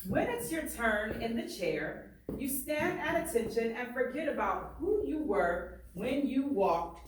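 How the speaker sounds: lively read-aloud, painting each picture; story reading. Acted. Teacher reading a barbershop story aloud to students, lively. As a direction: read it to the class with swagger and pride. Reads the book aloud to class.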